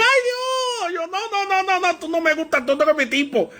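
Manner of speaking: very high, comically flustered protest; squeal, then rattle off the refusals in mock alarm; mock panicked denial. Preacher replays denying interest in the waiter, mock panicked denial.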